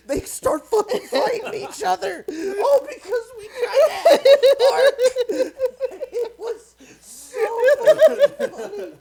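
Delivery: high, loud, breaking into laughter; crying with laughter through it; laughing hard. Real, not acted. Telling friend the story, laughing till crying. Cracking up retelling.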